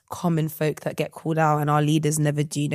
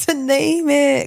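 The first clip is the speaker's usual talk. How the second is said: laughing; 10 semitones higher, 3 dB louder, slower than usual. Real, not acted. Laughing echo.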